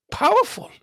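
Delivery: powerful jumps high and loud; a big peak on powerful; punched word. Real, not acted. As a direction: hit powerful hard and high. Emphatic build to key word.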